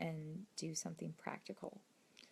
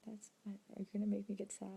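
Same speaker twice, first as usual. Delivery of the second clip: ordinary talk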